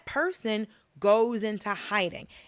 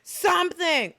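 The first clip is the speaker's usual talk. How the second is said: slow, high, emphatic plea; slow, pitched-up demand; exasperated urging; 8 semitones higher, 3 dB louder, slower than usual. Real, not acted. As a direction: drag out each word in exasperation.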